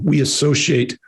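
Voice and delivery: ordinary talk, nothing marked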